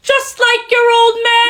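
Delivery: high, loud, overwrought romantic declarations; hammed-up Ferrelton. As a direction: swoon over a Valentine in character. Ferrelton taunting son.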